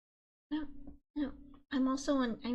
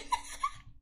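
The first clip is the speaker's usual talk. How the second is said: high squeaky laugh. Real, not acted. Laughing at bad grade.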